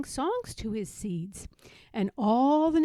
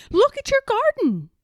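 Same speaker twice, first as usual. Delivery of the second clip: calling out; 12 semitones higher, 11 dB louder, faster than usual. A narrator's reading. Frog calling sleeping Toad.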